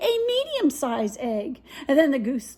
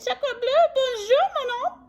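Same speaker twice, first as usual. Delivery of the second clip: very high French-flavoured greeting; baby duck voice; 11 semitones higher than usual. Acted. Baby duck to Jack, very high tiny greeting. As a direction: chirp it as a newborn duck.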